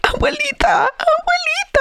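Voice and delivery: very high mock-crying child wail; squeaky, tearful child impression; crying little girl. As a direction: imitate a tiny girl wailing for grandma. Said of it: "the little girl starts crying".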